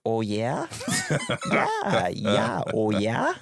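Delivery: mock Minnesota drawl on "oh, yeah?"; lightly done Minnesota accent. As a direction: play the Fargo local, amused. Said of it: "wanna do the accent".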